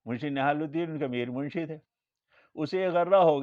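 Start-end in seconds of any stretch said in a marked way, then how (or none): none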